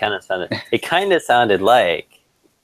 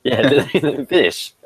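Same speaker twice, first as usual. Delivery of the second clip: laughing; 7 semitones higher than usual. Real, not acted. Laughing along.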